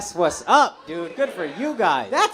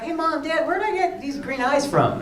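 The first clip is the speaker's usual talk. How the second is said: put-on innocent kid; 6 semitones higher than usual. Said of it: "I'd be like".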